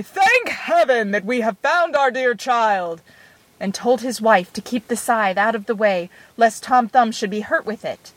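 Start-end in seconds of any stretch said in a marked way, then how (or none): 0.0-3.0 understated relief, called out; a touch of joy as you call out; joyful call. A narrator's reading. Father finding his child, mildly joyful call. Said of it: "called out full joy".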